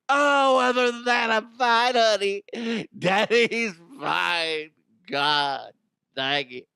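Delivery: high, loud strained fake cheer; forced cheerful dad. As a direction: pretend you're fine through the pain. Dad pretending fine to daughter.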